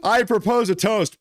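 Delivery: high, playful toast-giver; lightly hammed-up call for a toast; mock toast. Repeats mock toast after laughing.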